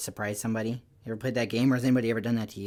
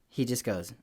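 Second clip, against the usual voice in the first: plain reading voice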